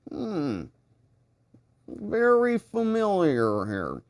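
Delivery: drawn-out thoughtful hmm, slow; musing pause. Retold or imitated. Streamer recalling a familiar chat name, slow and musing. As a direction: mull it over slowly, half to yourself. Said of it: "you saw me pause on your name like".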